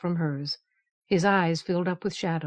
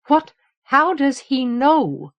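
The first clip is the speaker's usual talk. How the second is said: high, loud, slow, shocked repeat; astonished echo; 6 semitones higher, 7 dB louder, slower than usual. Acted. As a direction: echo it back in disbelief. Garrardin echoes it in astonishment.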